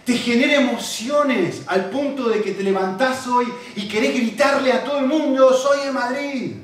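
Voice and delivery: impassioned sermon climax; rising passion. Acted. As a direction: drive it home with force. Building to passionate shout.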